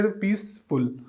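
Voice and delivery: Peaceful in two separate beats, पीस, then फुल; split into syllables. Shown as a demonstration. Teacher showing how we read it, peaceful split as piece-full. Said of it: "हम लोग इस तरीके से".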